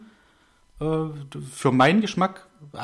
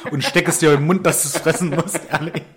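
loud, laughing mock threat; 3 semitones higher, 6 dB louder than usual. Real, not acted. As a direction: giggle through a playful threat. Laughing mock threat about ponytail.

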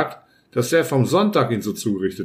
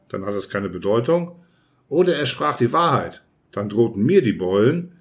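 ordinary delivery

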